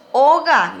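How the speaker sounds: "oga" with a firm stress on the O. Shown as a demonstration. Teacher models a Guarani word for pupils, stressed O. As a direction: hit the first vowel of "oga". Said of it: "lleva acento en la O".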